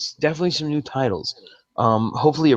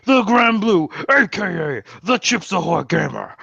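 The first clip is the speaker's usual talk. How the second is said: high, hammed-up farewell announcement; grand sign-off; 5 semitones higher than usual. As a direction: announce yourself like a show host. Theatrical podcast sign-off.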